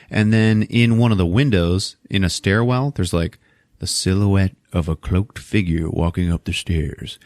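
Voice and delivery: usual speaking voice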